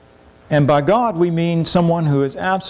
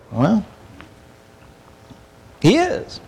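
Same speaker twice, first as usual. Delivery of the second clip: ordinary talk, nothing marked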